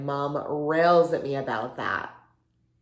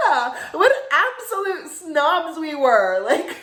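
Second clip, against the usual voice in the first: loud, higher, giggling through the words; laughing self-mockery; 10 semitones higher, 7 dB louder than usual. Recalling theater kids' fake prom with friend, laughing self-mockery. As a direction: crack up while calling yourselves out. Laughing at their teenage selves.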